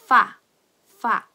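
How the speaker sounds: "파" said as fa, with f not p; English-style F. Shown as a demonstration. Teacher showing how F should sound, "파" pronounced fa. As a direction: blow a clear f at the start of "파". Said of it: "영어 F와 동일한 발음".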